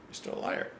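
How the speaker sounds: very soft, voiceless quoted jab; hushed accusation. Retold or imitated. Reactor repeating the singer's line for the third time, hushed. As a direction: drop the accusation almost to a whisper.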